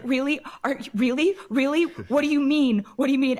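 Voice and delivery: high, loud, rapid-fire disbelieving questions; retold disbelief. Retelling her reply to mom's news, disbelieving. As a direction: re-enact stunned questions, pitch jumping up. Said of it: "just weeping and kind of, like, unable".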